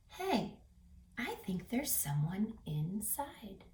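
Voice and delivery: hushed secret whisper. Acted. Curious animal whispers to friends, hushed. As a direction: whisper it so the box won't hear.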